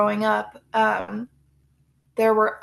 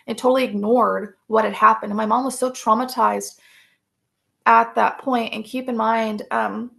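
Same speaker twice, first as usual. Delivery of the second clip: ordinary delivery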